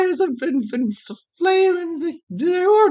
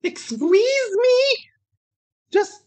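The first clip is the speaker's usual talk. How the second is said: ordinary talk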